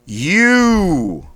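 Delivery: You punched out high and loud in one breath; thrust out sharply. Shown as a demonstration. Coach drilling warm-up, sharp loud thrust-out You. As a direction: dump all the air on You. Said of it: "Thrust out quickly, sharply".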